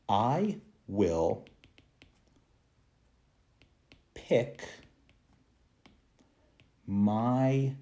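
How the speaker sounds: slow word-by-word example. Shown as a demonstration. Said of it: "Native speakers like me often will say".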